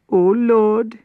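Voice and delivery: high, loud cry of grief; sobbing plea. A narrator's reading. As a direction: wail the plea to the Lord. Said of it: "she sobbed".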